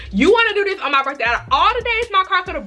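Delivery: fed-up outburst at a stalled car; exasperated scolding. Retold or imitated. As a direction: tell the car off in disbelief. Scolding her broken car.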